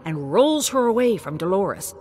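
ordinary delivery

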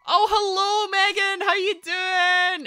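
high, loud, slow sing-song hello; drawn-out greeting. Real, not acted. Streamer welcoming a viewer, drawn-out sing-song greeting. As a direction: stretch the greeting out like a host.

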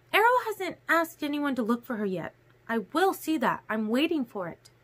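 high, light character voice; Alice, certain. A narrator's reading. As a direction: state it surely in a high voice. Narrator voices Alice in dialogue.

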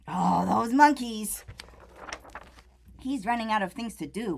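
ordinary delivery

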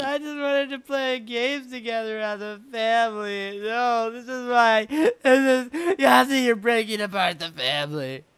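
high, slow, loud wailing; hammed-up family-tragedy wail; mock sobbing lament. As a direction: build to a melodramatic fake cry. Melodramatic lament over broken team.

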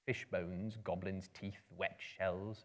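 ordinary delivery

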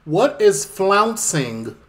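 slow, over-clear retry to the phone; very slow, deliberate retry to a voice assistant; slow for Siri. Real, not acted. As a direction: drag each word out so Siri gets it. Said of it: "Could you try again?".

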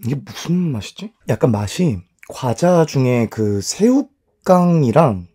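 talking between bites; chewing while talking. Real, not acted. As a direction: mouth full of chicken. Seen 0: bites chicken at -3, cheeks full, mouth working while talking.

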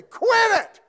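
high, loud sharp shout "Quit it!"; parent shouting at kids. Retold or imitated. Parent snapping at misbehaving kids, loud shout. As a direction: bark it like a fed-up parent.

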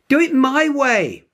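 put-on bossy child shouting an order, slowly; yelling. A narrator's reading. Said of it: "yelled Janie".

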